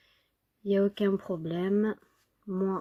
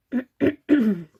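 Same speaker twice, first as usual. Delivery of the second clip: throat clearing; 3 semitones higher, 6 dB louder than usual. Real, not acted. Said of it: "Pardon, excusez-moi".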